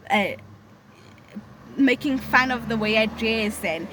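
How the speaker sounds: usual speaking voice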